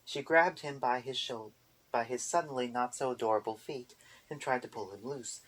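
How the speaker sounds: usual speaking voice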